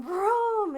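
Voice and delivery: a loud, high imitated thunder growl; vocal thunder effect.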